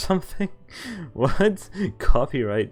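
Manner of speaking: ordinary delivery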